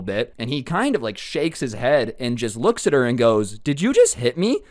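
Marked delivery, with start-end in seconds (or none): 3.6-4.6 higher and louder stunned question; disbelief. Retold or imitated. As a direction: ask like you cannot believe she hit you. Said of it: "he literally can't believe what just happened".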